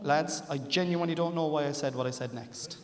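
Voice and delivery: usual speaking voice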